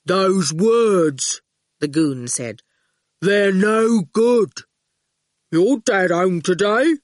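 put-on loom over the boy and state it plainly; slow hulking goon. A narrator's reading. Goon grinning at the boy outside school, lightly hulking.

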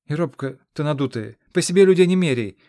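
put-on indignant boy. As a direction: snap back like an offended youth.

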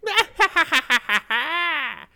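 high, loud evil cackle thrown back; witch's cackle. Acted. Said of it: "let out a witch's cackle".

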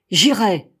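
loud, firm insistence; defiant. A narrator's reading. Captain answering the doctor's objection, defiantly loud. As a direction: refuse to be stopped.

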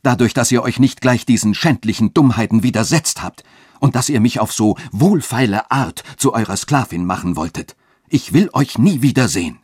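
loud, high-pitched, scornful outburst; sharp, forceful denunciation; cutting tone. A narrator's reading. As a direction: lash out with indignant contempt. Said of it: "rief sie mit schneidendem Ton".

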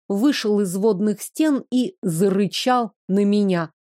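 ordinary delivery

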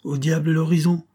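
a sharp, dry retort; curt. A narrator's reading. Young man brushes off the major, curtly. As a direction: snap it off short and dry. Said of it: "dit le jeune homme d'un ton sec".

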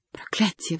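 plain reading voice

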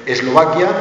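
usual speaking voice

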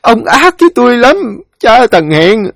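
high, loud keening lament; heart-rending wail. Acted. Widow crying over her dead husband, wailing in anguish. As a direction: wail your grief out, sobbing through the words. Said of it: "Tiếng khóc đầu tiên ... khóc chồng xé lòng".

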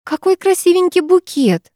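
put-on high, lilting girlish admiration; sugary singsong gush; singsong cooing. A narrator's reading. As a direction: sing it out sweetly, well up in pitch. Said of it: "пропела Викуля".

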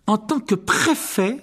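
high, slow self-important voice; pompous prefect. Acted. Percy invoking his prefect status, high and pompous. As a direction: puff up with authority.

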